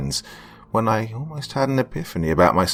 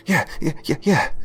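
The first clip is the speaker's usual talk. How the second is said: high, rapid eager yeahs; a boy jumping up and down, answering excitedly; excited little boy; 9 semitones higher than usual. A narrator's reading. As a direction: bounce on every yeah like a thrilled child.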